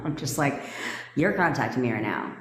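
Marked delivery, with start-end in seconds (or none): 1.2-2.3 low, controlled command; firm and low, no need to yell; backbone without yelling. Shown as a demonstration. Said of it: "I'm not yelling at the fucking person".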